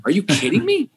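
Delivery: put-on you can't be serious, I set up the biggest boy band; incredulous protest. Retold defensive reply to boss.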